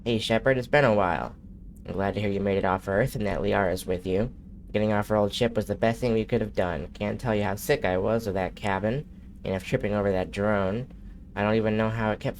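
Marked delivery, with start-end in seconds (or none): none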